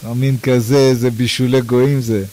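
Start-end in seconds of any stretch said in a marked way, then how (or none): none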